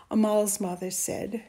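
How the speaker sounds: ordinary delivery, nothing marked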